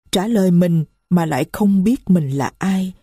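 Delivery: ordinary delivery, nothing marked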